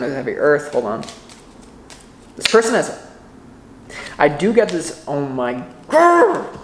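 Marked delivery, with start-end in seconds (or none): 5.9-6.6 high, loud playful growl; excited grrr. Real, not acted. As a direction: growl with delight. Excited growl about earthy match.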